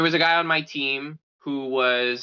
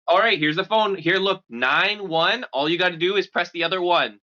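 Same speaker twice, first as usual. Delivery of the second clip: a dad's taunt voiced higher; higher, lightly hammed dad; mock dad threat; 8 semitones higher than usual. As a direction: tease like a menacing dad. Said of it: "the dad's like".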